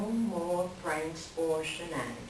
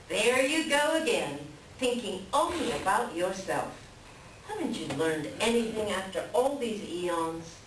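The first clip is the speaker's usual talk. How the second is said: ordinary talk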